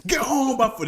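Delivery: sudden high angry yell; mock angry read. Reader overreacting to the script's punctuation, abrupt mock-angry yell. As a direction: burst out as if spotting the exclamation mark. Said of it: "He's angry. I saw exclamation mark".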